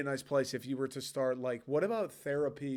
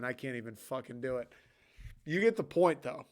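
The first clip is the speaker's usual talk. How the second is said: ordinary talk, nothing marked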